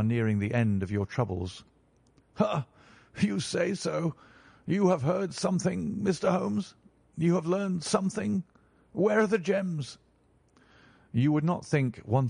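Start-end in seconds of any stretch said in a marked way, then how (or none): 2.4-10.0 higher, urgent questions tumbling out; a desperate man grasping at hope; eager excitement. Acted. Banker hears gems found.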